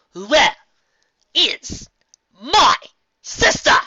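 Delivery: each word punched loud and separate; punching each word. A narrator's reading. Nightmare Moon demands her sister, each word punched loud. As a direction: slam down every word like a hoof. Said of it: "punching each word with a slam".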